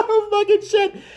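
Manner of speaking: laughing it out, high and slow. Real, not acted. Laughing at his own joke, a high laughing curse. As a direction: crack up while swearing.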